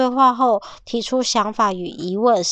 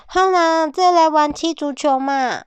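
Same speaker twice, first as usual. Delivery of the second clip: higher, pleading 嘛 ending; coaxing girl cat; 6 semitones higher than usual. Acted. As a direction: sweetly beg the wolf to play. Cat voiced coaxing the wolf.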